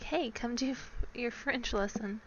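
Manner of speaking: usual speaking voice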